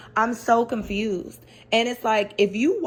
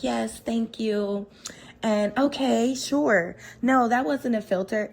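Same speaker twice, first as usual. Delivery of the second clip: put-on lightly higher, sugary little-girl voice; mock baby voice; 3 semitones higher, slower than usual. Host mocking a cast member's babyish voice toward Giselle. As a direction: imitate a meek little sister, a touch babyish. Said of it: "she puts on this baby voice".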